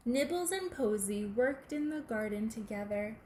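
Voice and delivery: plain reading voice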